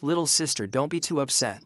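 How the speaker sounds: ordinary delivery, nothing marked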